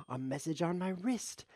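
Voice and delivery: sudden high gasp of surprise; surprised gasp. Real, not acted. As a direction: caught off guard by his watch. Gasps at a notification.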